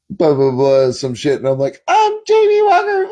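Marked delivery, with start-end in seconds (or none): 1.9-3.1 very high, silly put-on squeal; mocking impersonation. Clowning for a friend on the ski lift, squealed mockery. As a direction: squeal it in falsetto, dissolving into a wail.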